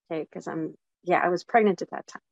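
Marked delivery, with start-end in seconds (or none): none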